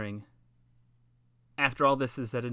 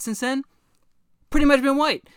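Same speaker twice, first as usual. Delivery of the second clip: sarcastic; 11 semitones higher, 8 dB louder than usual. Real, not acted. Sarcastic punchline build.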